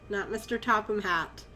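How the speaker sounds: usual speaking voice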